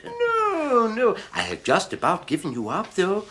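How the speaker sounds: high, fawning shopkeeper's voice; oily old shopkeeper. Acted. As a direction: greet the customer like an unctuous old dealer. Shopkeeper character voice.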